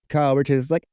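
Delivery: normal talking voice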